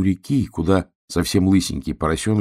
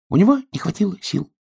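little duck voice; 11 semitones higher, faster than usual. Acted.

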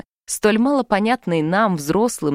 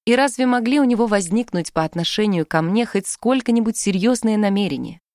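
ordinary delivery, nothing marked

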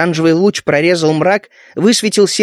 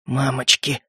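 soft, lower frightened whimper; frightened babble; 6 semitones lower, 7 dB quieter, slower than usual. A narrator's reading. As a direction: babble it out in fear. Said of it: "пролепетал Сахарок".

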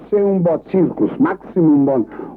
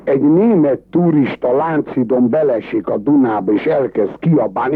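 usual speaking voice

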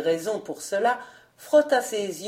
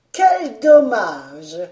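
slow, much louder mock regret; sarcastic; 10 dB louder, much slower than usual. A narrator's reading. Goton mocking the servants' loss, slow and loud. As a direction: drag out fake sympathy. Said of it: "avec un sarcastique sourire".

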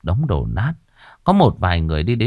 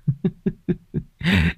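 put-on drunken chuckle; 7 semitones higher, 4 dB quieter than usual. A narrator's reading. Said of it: "lè nhà lè nhè".

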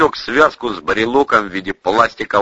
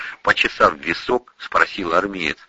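ordinary delivery

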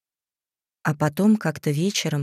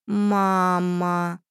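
sad creepy doll voice; 2 semitones higher than usual. A narrator's reading. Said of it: "Голос куклы изменился, стал ниже и печальнее".

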